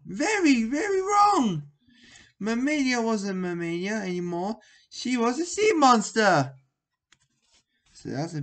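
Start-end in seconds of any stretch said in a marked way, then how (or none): 0.1-1.6 shouting much higher and louder; dramatic storytelling yell; excited shout. A narrator's reading. Scary story climax, shouted. 2.4-6.5 excited reveal. A narrator's reading. Scary reveal in story.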